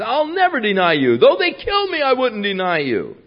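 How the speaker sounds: frantic denial, pitched far up; Peter's protest. Acted. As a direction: insist desperately, voice climbing. Peter's boast acted out.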